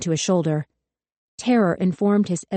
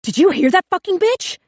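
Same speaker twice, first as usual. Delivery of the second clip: much higher, louder, far shriller voice; hysterical scream; 13 semitones higher, 6 dB louder than usual. A narrator's reading. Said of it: "the cop screamed".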